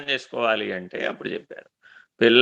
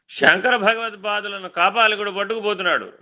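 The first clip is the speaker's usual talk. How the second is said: ordinary delivery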